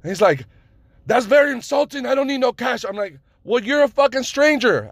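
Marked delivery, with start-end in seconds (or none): 1.0-2.8 high, offended mock Middle Eastern accent; bad Middle Eastern accent. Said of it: "I'm doing the fucking accent, the shitty accent". 3.4-4.9 high, loud, re-enacted outrage; pissed off. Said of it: "At this point, I was pissed".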